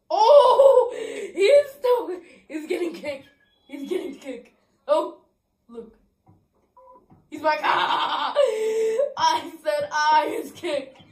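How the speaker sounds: high gleeful squeals through laughter; laughing glee. Real, not acted. Gamer cackling as the rival is thrown out, laughing. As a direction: laugh as he gets kicked.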